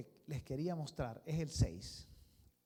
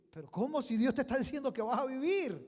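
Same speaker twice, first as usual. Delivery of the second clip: exasperated; 8 semitones higher, 8 dB louder than usual. Acted. Prophet insisting, exasperated.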